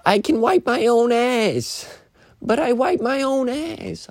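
put-on high, slow kid voice; Big Daddy quote. Quoting the Big Daddy kid, in a high slow kid voice. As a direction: say it like a stubborn little kid. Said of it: "I'm talking about Big Daddy".

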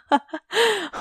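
a high burst of embarrassed laughter. Real, not acted. Vlogger laughing at her own mistake, a high giggle. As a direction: laugh off the stumble.

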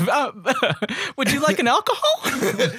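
high and slow through laughter; laughing. Real, not acted. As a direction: giggle the offer out. Laughing joke offer.

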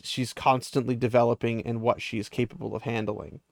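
usual speaking voice

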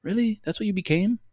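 put-on high, let-down kid voice; mock crying child. Eight-year-old self confronting the disappointing grown-up, mock tearful. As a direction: imitate a child's tearful disbelief. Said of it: "eight-year-old self would start crying".

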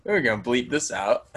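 ordinary delivery

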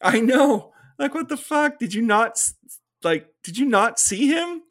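high, loud, fed-up disbelief; incredulous rant. Real, not acted. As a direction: rant at a character who won't listen. Ranting at the heroine's logic.

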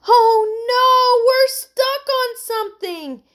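high, loud cry of dismay; alarmed. A narrator's reading. Heidi as the boat gets stuck, alarmed. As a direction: wail it high and drawn out.

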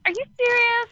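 high incredulous question; surprised. Real, not acted. Disbelief at sound complaint.

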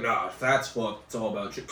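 a hint of a smug expert; mock scientist voice. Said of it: "They've been like".

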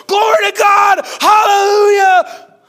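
high, ringing revival shout; shouted praise. Retold or imitated. Preacher recalling the camp revival, shouting praise. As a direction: cry it out like a camp meeting.

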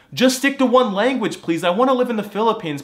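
high, loud begging; exasperated plea. Real, not acted. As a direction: plead loudly, fed up. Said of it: "this makes me so sad".

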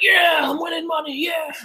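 high, shouting whoop of joy; drunken whoop. Retold or imitated. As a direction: yell it like you just hit the jackpot. Drunk gambler winning big.

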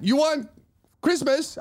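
put-on high, puzzled Chinese lady's accent; high-pitched Chinese-accented questioning. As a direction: mimic a baffled woman with a Chinese accent. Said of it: "do the accent for that story".

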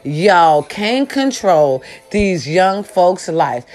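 drawn out slowly, each word weighed; slow emphatic repeat. Shown as a demonstration. Vlogger repeating her rant line to seniors, slow and emphatic. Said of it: "One more time".

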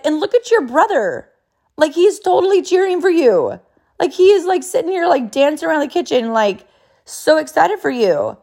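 retold excited praise. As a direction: retell it as a mom pointing out the cheering. Mother retells praising the cheering brother.

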